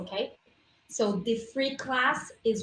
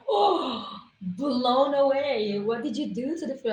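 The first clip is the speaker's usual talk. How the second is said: drawn-out, higher gasp of wonder; mock amazement; 4 semitones higher, 4 dB louder, slower than usual. Diners bowled over by her cooking, mock amazement. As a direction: imitate an astonished diner, stretching the words.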